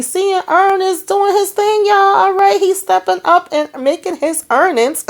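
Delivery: high singsong cheering-on; playful hyping. Real, not acted. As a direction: hype him up in a very high voice. Hyping Ern stepping up.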